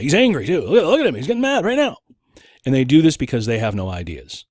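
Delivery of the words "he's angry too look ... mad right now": high, loud tattling, rising higher; mock taunting. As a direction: point them out to the crowd gleefully. Acts opponents pointing at angry man.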